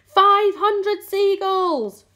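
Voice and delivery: very long, high, loud tally; shout. A narrator's reading. James announcing five hundred caught, shouting. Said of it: "James shouted".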